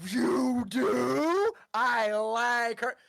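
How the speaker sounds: put-on half-elf won over by the new girl, delighted. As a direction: draw it out, pleased. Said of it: "the half-elf's like".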